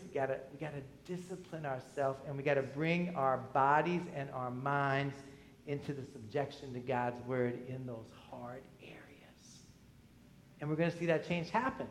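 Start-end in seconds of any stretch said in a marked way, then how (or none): 5.7-9.6 hushed emphasis. Real, not acted. Sermon point trailing to hush.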